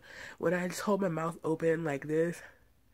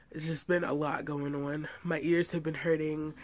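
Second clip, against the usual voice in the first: ordinary delivery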